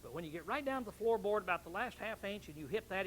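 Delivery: ordinary talk, nothing marked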